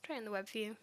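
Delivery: dry, mocking suggestion; sarcastic. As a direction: say it with sarcastic scorn. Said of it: "This is where I'm gonna get sarcastic with you".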